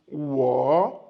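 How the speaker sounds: usual speaking voice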